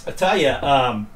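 winded from climbing the stairs; breathing hard between words. Real, not acted. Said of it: "you can tell I'm winded".

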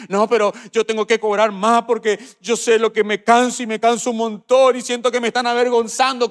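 high, loud whining complaint; whiny complainer. Acted. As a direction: play the worker grumbling about unfair pay. Complaining worker voiced in sermon.

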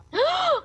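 high sudden gasp of delight; sharp excited gasp. Real, not acted. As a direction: gasp at a great find. Finding loot in game.

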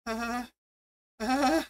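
a hint of panic rising through the uhs; mock nervous stammer. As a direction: caught kid stalling for words. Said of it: "And you're just like".

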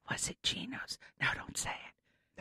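hushed, voiceless secret-sharing; conspiratorial whisper. Pretend. Host slyly asking guest which sandwich shop it was, whispered. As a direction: whisper it like forbidden gossip.

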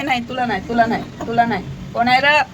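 usual speaking voice

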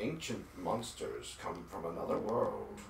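slow, low dramatic narrator voice; ominous narrator. Retold or imitated. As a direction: intone it like a movie trailer. Voicing game character line.

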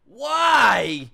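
high, slow, drawn-out wail of admiration; overwhelmed raving. Real, not acted. Fan overwhelmed by the soundtrack, ecstatic wailing. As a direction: wail it out, stretching the final WHY.

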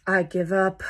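resigned line ending in a sigh; mild mock exasperation, breath let go; giving up. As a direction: give up and let out a big sigh. Worm gives up teaching spider.

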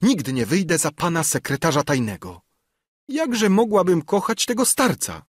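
put-on high-pitched, appalled young lady; defiant daughter. A narrator's reading. Albertyna refuses to marry the old man, protesting.

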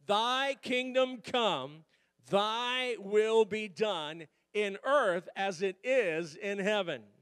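slow, loud, pushing recital; urging unison. Shown as a demonstration. Pastor urging a tired congregation to read aloud, slow and loud. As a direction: drive them to read along. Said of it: "Come on, help me".

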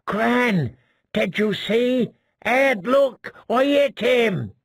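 bursting to tell everyone; excited shout. Acted. Fat boy calling to Gren and Ed, excitedly shouting. Boy thrilled at his hit.

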